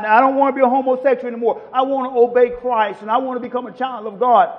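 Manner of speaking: ordinary delivery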